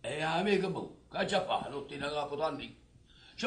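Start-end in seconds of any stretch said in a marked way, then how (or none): none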